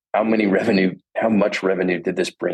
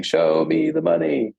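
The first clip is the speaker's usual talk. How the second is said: high, drawn-out mock scream; Jerry Maguire; 9 semitones higher, slower than usual. Sports agent screaming to keep his last client, mock-scream imitation. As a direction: stretch it out like a yell, without real volume. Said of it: "and he's screaming".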